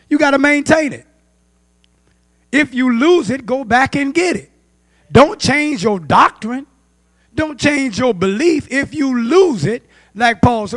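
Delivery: high, forceful preaching, drawn-out commands; urgent exhortation. Real, not acted. Preacher urging congregation to keep doctrine, urgent exhortation.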